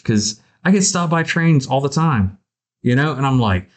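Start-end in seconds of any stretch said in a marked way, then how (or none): none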